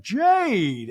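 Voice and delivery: high, loud warning on Jade; a mother scolding her son; scolding name call. Retold or imitated. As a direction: call his name like a mom. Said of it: "Garcelle's like".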